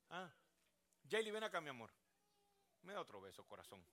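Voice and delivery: soft, low, loving call to Jaylee; tender grandpa voice. Real, not acted.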